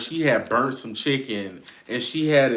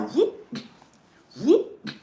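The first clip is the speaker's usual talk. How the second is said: much higher sound effect whoops; mock whoop; 13 semitones higher, 3 dB louder than usual. Mimicking Paula's quick rinse, high whoop sounds. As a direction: squeak out whoop, whoop for the quick dunk. Imitating wing dipped in water.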